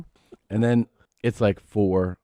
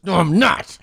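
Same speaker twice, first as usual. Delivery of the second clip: put-on indignant kid; 10 semitones higher, 5 dB louder, slower than usual. Dad voices indignant son.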